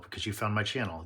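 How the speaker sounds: ordinary talk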